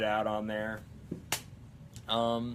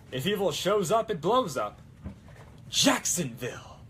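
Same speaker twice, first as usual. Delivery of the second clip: louder, higher action-hero quote; Ash; 6 semitones higher, 4 dB louder than usual. Acted. Cosplayer quoting Ash versus Evil Dead, loud impression. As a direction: punch out the hero's one-liner. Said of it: "think of an Ash versus Evil Dead quote".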